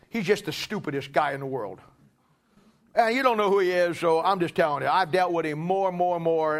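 usual speaking voice

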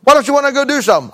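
a husband running out of patience; exasperated, pleading husband. Retold or imitated. Acted husband exasperated with wife.

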